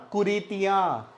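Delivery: कुरीतियाँ slow with nasal याँ; कुरीतियाँ, long nasalized last vowel; nasal ending. Shown as a demonstration. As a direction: stretch the end of कुरीतियाँ through the nose. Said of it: "वो नाक से लगती है".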